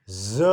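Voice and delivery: Z as "zuh", not the letter name; isolated "zuh" for Z; voiced consonant demo. Shown as a demonstration. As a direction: sound out Z with a short vowel.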